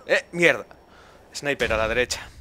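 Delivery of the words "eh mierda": loud curse. Real, not acted. Sniper surprise in firefight.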